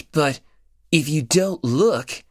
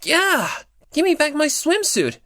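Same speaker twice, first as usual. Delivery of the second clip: much higher, quick squeaky mock line; mock flat game line; 11 semitones higher than usual. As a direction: parody a bland game character's cry, high and hurried. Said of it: "and it just says".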